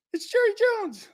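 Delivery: very high squeaky dummy voice; ventriloquist's puppet. Acted. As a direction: pipe up like a little puppet. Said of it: "Is that you talking or Jerry?".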